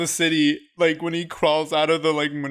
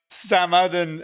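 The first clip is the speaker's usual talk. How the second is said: ordinary talk, nothing marked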